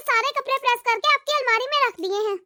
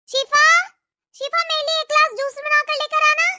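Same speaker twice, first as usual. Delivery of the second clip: raised, carrying summons; calling out; 2 semitones higher, 3 dB louder than usual. Acted. Sister-in-law orders juice from Shifa, calling out. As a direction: call across the house with an order.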